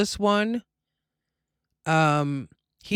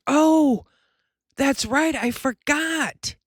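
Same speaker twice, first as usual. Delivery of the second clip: high, loud sudden realization; the penny drops loudly for brother; sudden recall; 10 semitones higher, 5 dB louder, slower than usual. Retold or imitated.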